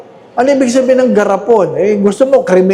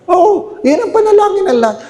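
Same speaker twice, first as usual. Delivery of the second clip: pitched way up, quick and eager; emphatic high exclaim; 10 semitones higher than usual. Real, not acted. Scene: priest tells churchgoers everyone prays this, high and quick. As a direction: insist it brightly, racing.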